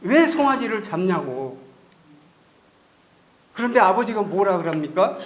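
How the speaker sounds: ordinary talk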